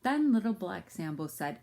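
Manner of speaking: ordinary delivery, nothing marked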